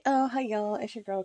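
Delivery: ordinary delivery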